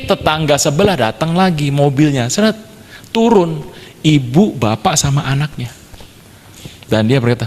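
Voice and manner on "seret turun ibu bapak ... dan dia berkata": normal talking voice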